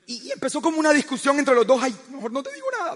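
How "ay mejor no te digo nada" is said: quick, soft hurt mutter; sulky wife. Retold or imitated. Wife backs off mid-argument, quick hurt mumble. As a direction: toss it off, wounded and quiet.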